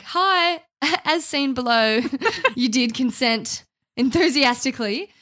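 mock-polite message through laughter; laughing mock email. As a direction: read out a pointed email while cracking up. Acted pointed email, laughing.

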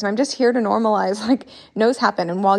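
ordinary talk, nothing marked

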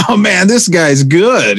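slow, high mock heckle from the crowd; a hint of a sarcastic heckler in the front row. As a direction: call it out like a fake heckler. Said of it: "I start pretending to heckle".

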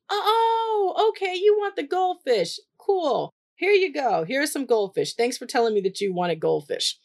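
put-on high bright sing-song praise for a small child; delighted praise.

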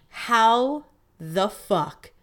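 very slow, each word stretched; drawn-out disbelief. Retold or imitated. Customer after sipping bad cocoa, slow drawn-out disbelief. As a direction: drag every word out in disbelief.

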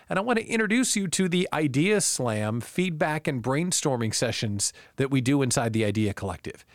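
normal talking voice

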